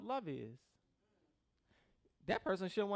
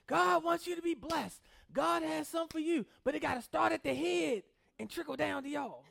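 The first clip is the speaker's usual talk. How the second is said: prosperity preacher; 6 semitones higher, 5 dB louder than usual. Pretend. Preacher parodying a televangelist to his congregation, hammed-up boom. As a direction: ham up a money-hungry TV preacher.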